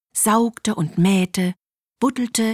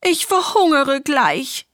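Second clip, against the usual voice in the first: higher-pitched wailing complaint; whining duck; 6 semitones higher, 4 dB louder than usual. A narrator's reading. Duck complains to the hen of hunger, whining. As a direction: whine like a hungry, impatient duck. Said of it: "jammerte die Ente".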